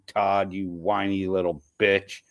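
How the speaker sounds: ordinary delivery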